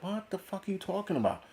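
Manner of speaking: mock bewildered outburst; incredulous. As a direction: react like the claim is insane. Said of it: "You're like".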